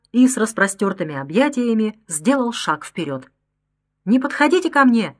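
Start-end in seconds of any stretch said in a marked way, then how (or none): 4.1-5.2 higher, pleading cry of despair; a woman's desperate outcry. A narrator's reading. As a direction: cry out in desperation to keep him away. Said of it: "в отчаянии вскрикнула".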